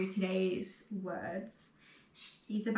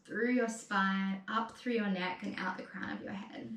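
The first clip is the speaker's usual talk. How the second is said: guided meditation. Acted. Guided seated breathing cues.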